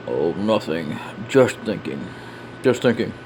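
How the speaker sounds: low, subdued character voice; brooding reply. Acted. Character found out alone at night, low brooding reply. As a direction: drop the pitch and brush off concern.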